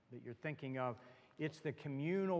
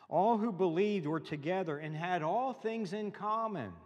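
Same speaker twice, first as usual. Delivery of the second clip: raised, emphatic public Bible reading; scripture reading; 3 semitones higher, 6 dB louder than usual. Acted. Pastor reading Acts chapter two to congregation, emphatic scripture reading. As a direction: proclaim it, hitting phrase openings hard.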